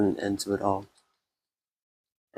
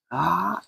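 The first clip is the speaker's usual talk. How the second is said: sudden high scream of shock; 9 semitones higher than usual. A narrator's reading. Hajime, waking into despair, screams in shock. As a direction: let out a short startled cry. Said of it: "I let out a scream".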